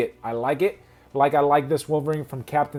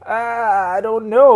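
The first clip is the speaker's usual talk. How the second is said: shrugging give-up; 8 semitones higher, 10 dB louder than usual. Real, not acted. Giving up on pronunciation.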